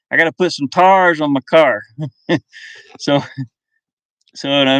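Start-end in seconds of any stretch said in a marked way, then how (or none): 0.1-1.8 put-on Southern accent. Streamer imitating a Southern kid talking about tires, in a Southern accent. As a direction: say "tars" like a country kid. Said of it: "when I was down south".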